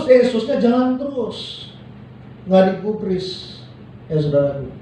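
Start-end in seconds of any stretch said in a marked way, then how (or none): none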